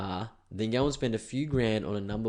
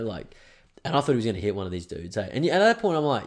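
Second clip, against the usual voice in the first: ordinary delivery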